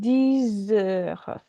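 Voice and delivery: dix heures drawn out, with X turned to a Z; liaison. Shown as a demonstration. Teacher shows what the X becomes, dix heures with a long Z link. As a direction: stretch "dix heures" into a slow "deee-zeur". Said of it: "X becomes the, the sound".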